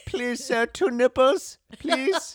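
very high, put-on beggar's voice; mock pleading. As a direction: beg in a silly high voice. Playful begging routine, put-on voice.

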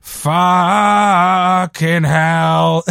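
drawn-out, very slow exasperated groan of a curse; hammed-up cross swearing, stretched long; mock fed-up swearing. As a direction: drag it out like you've had enough. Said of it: "as if they're really pissed off".

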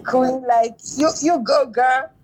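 high-pitched cheering-on of a friend; cheering fan. Retold or imitated. Fan cheering on a performer she's watching in videos, high cheering. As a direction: hype her up like a fan, voice lifted.

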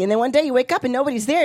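ordinary delivery

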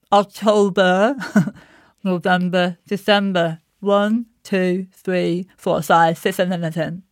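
talking with the tongue out. Shown as a demonstration. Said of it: "put your tongue out on your lower lip".

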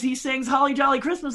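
usual speaking voice

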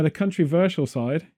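"controversial" pronounced "country-versial". Shown as a demonstration. Host insisting on his slip to co-host, "controversial" as "country-versial". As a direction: deliberately put "country" into "controversial". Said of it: "The country".

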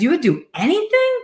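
high, rising disbelief; mother asks in surprise, pitched well up; incredulous question. Retold or imitated. Mother teasing her son's claim, high incredulous question.

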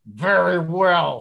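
slow, suppressed fury; seething dad. Retold or imitated. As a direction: draw it out as grudging acceptance. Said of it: "turned eggplant purple and was like".

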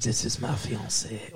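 low, soft mock-French voice; hammed-up French accent. Boyfriend introducing his fiancée in a mock French accent. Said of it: "my French accent".

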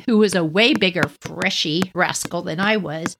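ordinary delivery

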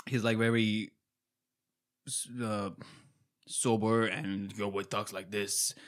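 lightly voiced low, slow Batman type; Batman-like sober voice. Podcaster imitating a character for co-host, low Batman-like voice. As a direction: imitate a sober, brooding hero, softly. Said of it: "he's basically Batman".

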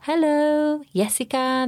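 put-on a teacher's cheery foreign-accented hello; Spanish teachers' English. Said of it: "some of the teachers would be able to go".

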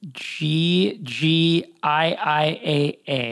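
letter by letter, very slow; spelled out slowly. Shown as a demonstration. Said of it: "you on Twitter are".

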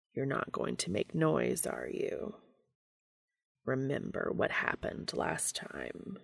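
hushed, breathy low growl. A narrator's reading. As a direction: growl it softly into his ear. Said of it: "Cass growled".

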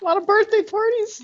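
squeaky high cartoon voice; helium voice. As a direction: pitch way up like helium. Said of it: "He's been in helium voice".

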